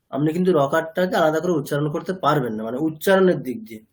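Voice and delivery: normal talking voice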